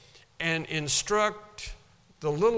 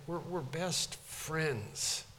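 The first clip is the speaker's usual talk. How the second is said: soft and slow, close and tender; hushed, heartfelt best friends; warm; 8 dB quieter, much slower than usual. Shown as a demonstration. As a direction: say it like a true friend, very gently. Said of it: "There's a warmness about what [name] is saying".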